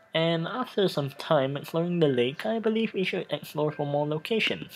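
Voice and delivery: usual speaking voice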